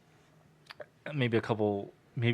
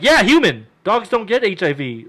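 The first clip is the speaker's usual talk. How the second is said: higher, louder exasperated; exasperated emphasis; 8 semitones higher, 8 dB louder than usual. Retold or imitated. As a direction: spell it out, exasperated. Scolding cousin.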